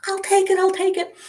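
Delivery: high, quick, eager repeat; eager grab. Retold or imitated. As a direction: snap it up like a kid in a candy store. Eager buyer retold.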